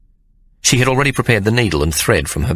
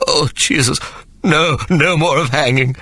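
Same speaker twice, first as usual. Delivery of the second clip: high, whimpering plea; 8 semitones higher than usual. A narrator's reading. Sir Jasper yielding to Hannah, high whimpering. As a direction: whimper out the promise. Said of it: "Sir Jasper whimpered".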